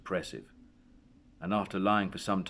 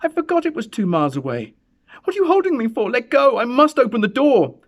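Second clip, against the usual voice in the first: much higher, much louder desperate pleading; frantic shouting; 12 dB louder than usual. A narrator's reading. Mother fighting her husband to reach the door, shouting. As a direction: scream at him to let go. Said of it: "she cried".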